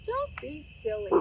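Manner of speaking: teasing Frog. A narrator's reading.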